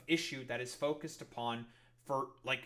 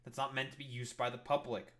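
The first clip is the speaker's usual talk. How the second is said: ordinary delivery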